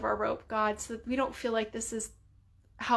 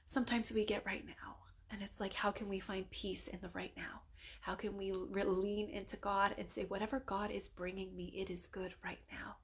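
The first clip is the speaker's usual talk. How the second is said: whispered; 10 dB quieter than usual. Real, not acted. Soft whispered prayer reflection.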